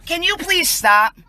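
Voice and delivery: ordinary talk, nothing marked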